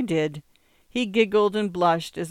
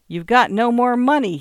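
loud, raised-pitch retort; yelling back; 6 semitones higher, 8 dB louder than usual. A narrator's reading. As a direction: shout the refusal back at him. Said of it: "he yelled back".

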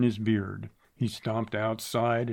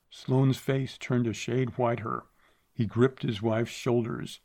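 plain reading voice

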